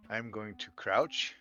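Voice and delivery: normal talking voice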